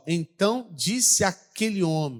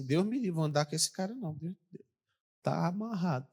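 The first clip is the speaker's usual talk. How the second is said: muttered rebuke; 7 dB quieter than usual. Retold or imitated. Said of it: "Eu falei assim".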